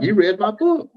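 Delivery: high squeal of surprise, imitating Amy; hammed-up delighted gasp; mock excited. Amy's excited reaction retold.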